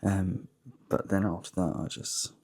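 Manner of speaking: hushed early-morning voice; quiet at night. Real, not acted. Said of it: "I'm gonna be quite quiet on my way down".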